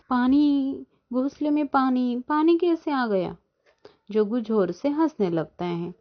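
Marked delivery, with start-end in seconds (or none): 0.1-3.4 high-pitched dismay, only slightly raised volume; startled cry. A narrator's reading. Bird finds water in her nest, high startled cry. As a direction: cry out in mock alarm, pitched well up. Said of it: "जोर से चिल्लाती है".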